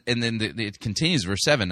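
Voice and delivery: usual speaking voice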